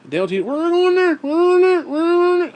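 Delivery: high strained panicked repeats; panicked pleading. Retold or imitated. Panic attack in the truck, high strained panicked voice. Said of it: "I can barely speak".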